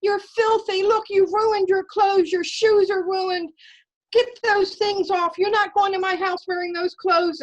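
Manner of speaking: high, loud furious scolding, partly slowed; mother screaming. Retold or imitated. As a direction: scream at the filthy kid like an enraged mom. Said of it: "started screaming".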